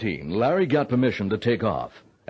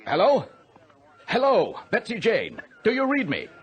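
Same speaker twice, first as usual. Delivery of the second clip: anxious calling out; 12 semitones higher than usual. Acted. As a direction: keep calling the lost pilot, growing alarm. Controller calls the lost plane.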